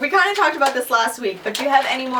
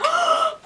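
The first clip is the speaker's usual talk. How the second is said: mock gasp. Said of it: "people are like".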